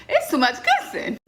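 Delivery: high-pitched, laughing protest; laughing exasperation. Real, not acted. Vlogger reacts to all the kissing on the show, laughing exasperation. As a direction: complain through a laugh, pitch jumping up.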